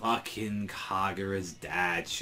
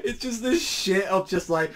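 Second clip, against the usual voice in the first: normal talking voice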